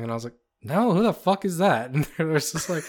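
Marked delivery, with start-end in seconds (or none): 0.6-1.9 higher, mock-exasperated question; indignant denial. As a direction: throw it back at the caller, playing baffled outrage. Retold denial to the caller.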